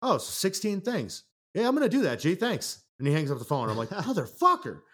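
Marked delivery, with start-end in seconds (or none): 0.0-2.8 high, bright mock friend stealing the idea; a friend blurting he'll take the idea, voiced high; dopey friend voice. Friend voiced in skit retelling. 3.9-4.8 mock outrage. Reacting in retold skit.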